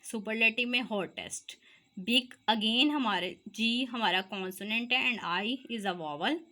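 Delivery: ordinary talk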